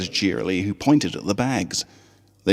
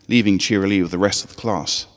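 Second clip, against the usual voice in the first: ordinary delivery, nothing marked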